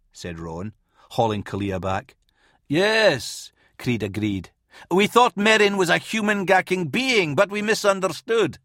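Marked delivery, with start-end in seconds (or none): 2.7-8.6 sarcastic. Acted. Creed mock agreeing sarcastically.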